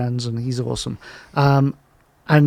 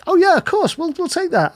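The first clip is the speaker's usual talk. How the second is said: mock eager client; 13 semitones higher than usual. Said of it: "you'd think they'd be like".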